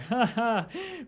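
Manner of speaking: a high-pitched burst of laughter. Real, not acted. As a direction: let the laugh fly up. Laughs again at the joke.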